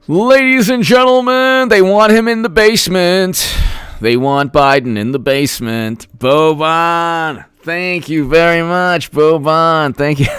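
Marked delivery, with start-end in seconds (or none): 0.1-3.5 high, booming showman's announcement; hammed-up announcer voice, pitched well up; mock announcer. 6.2-10.3 hammed-up thanks. Theatrical thanks to a donor.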